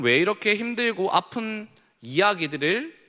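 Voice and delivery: ordinary delivery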